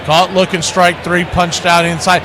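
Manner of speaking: usual speaking voice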